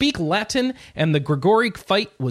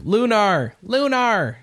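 calling out from the next room; from another room; 6 semitones higher, 4 dB louder, much slower than usual. Retold or imitated. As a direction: relay a shout from another room. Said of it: "Anna's shouting at me from the other room".

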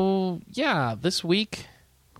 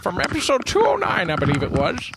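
put-on old-timey voice; 9 semitones higher than usual. Shown as a demonstration. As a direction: read it like an old-time radio host. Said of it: "I'm gonna read 'em in an old-timey voice".

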